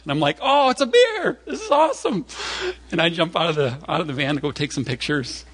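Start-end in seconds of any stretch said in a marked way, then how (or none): 0.4-2.3 high, giddy delight, acted out; excited outburst. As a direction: exclaim like a thrilled tourist. Retells delight at seeing bear.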